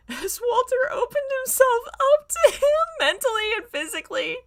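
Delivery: struggling to read through giggles; laughing while reading. Real, not acted. Reading a steamy fic aloud, breaking into high laughter. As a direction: voice squeaks up into laughter.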